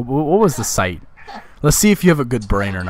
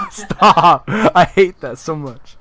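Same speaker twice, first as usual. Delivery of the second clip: loud, higher, laughing objection; laughing protest; 4 semitones higher, 7 dB louder, much slower than usual. Real, not acted. Objecting to a friend's fake sponsor plug, loud laughing protest. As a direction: protest through laughter, dragging it out.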